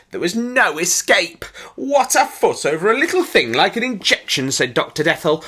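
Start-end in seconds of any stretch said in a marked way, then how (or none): 1.8-4.5 brisk dismissiveness. A narrator's reading. Doctor dismisses the boy's fear.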